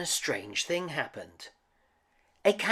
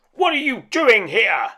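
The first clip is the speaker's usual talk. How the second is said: loud, higher roaring demand; loud beastly roar; 11 semitones higher, 14 dB louder than usual. A narrator's reading. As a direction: bellow at the stranger. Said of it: "he roared".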